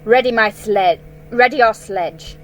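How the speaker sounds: loud commanding queen; sharp order. Acted. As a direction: order the servant out. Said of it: "ordered the witch".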